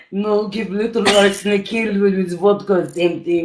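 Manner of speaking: put-on soft, slow comic Russian accent; Russian poisoner, played for laughs; mock Russian accent. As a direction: scheme casually in a Russian accent. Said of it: "Russian dog version of Flowers".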